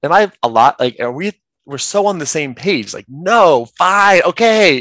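loud, high mock protest; retold exclamations. Retelling own reaction to a friend, exclaiming. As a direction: blurt it out, drawing out the last words.

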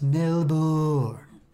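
Melbourne stretched out as mel-BOOOR; Melbourne with a long, exaggerated "boor" ending; lots of O's. Shown as a demonstration. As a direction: drag out the oo in Melbourne. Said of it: "with, like, a lot of O's".

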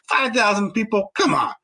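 ordinary talk, nothing marked

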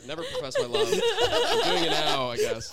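loud, laughing through the words; laughing confession. Real, not acted. Jokes about unconfessed crush, laughing.

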